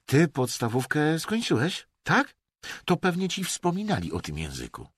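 high, impatient questioning; impatient snapping. A narrator's reading. Emerson throws the schooling jibe back, impatiently. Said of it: "warknął Emerson ze zniecierpliwieniem".

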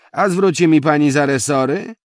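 loud, high, cocky challenge; conceited tone. A narrator's reading. Driver asks passenger to pay for springs, loudly conceited. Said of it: "ciągnął mężczyzna tym samym zarozumiałym tonem".

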